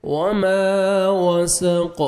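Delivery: "وما وسق" slow, the aa after m held about a second; stretched meem. Shown as a demonstration. Teacher shows the stretch, long aa in "وما وسق". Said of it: "میم کو one second کھینچنا ہے".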